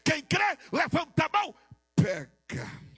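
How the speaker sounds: loud, high preaching that swells into shouts; preaching at full force. Acted. Pastor stirring the congregation, preaching at full force. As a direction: rise to a fever pitch and shout the promise.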